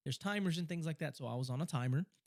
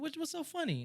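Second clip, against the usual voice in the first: puzzled question. Retold or imitated. Acting his puzzled question to laughers.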